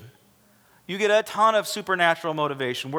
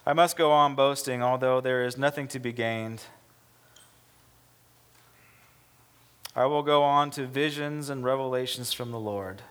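louder, solemn scripture read over music; 3 dB louder than usual. Acted. Pastor reading Corinthians aloud to the church, loud and solemn. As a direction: proclaim the apostle's words.

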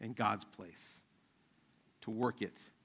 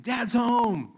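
put-on high, loud little-kid announcement; excited little one; 11 semitones higher, 11 dB louder than usual. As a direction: imitate a small child running in to announce dad. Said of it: "my little one running and saying".

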